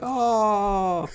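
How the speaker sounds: high, drawn-out "oh" of a charmed group; imitated crew cooing. Film crew reacting to the young star, mock collective coo. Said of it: "the whole crew was like".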